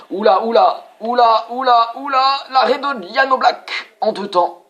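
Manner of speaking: high, loud repeated oula, slowing; startled commentator at a double save; alarmed excitement. Real, not acted. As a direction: gasp at a close call. Keeper save in live play.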